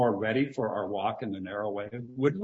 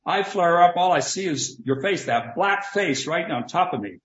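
higher and louder, a hot-headed player working himself up; 5 semitones higher, 5 dB louder than usual. Acted. [name] acting a hot-headed opponent to test Robinson, heated and loud. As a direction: play the angry rival ready to fight. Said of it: "I'm a hot-headed player".

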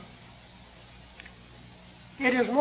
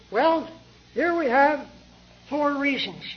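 normal talking voice

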